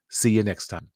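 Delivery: ordinary delivery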